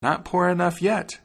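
high-pitched bird taunt; high, vengeful little bird; taunting sparrow. A narrator's reading. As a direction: pipe it high, rising at the end. Sparrow taunting the ruined wagoner.

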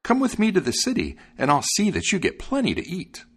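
high, eager bird character; high-pitched, encouraging little bird; sparrow voice. A narrator's reading. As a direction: pipe up high as a helpful sparrow. Sparrow comforting the dog.

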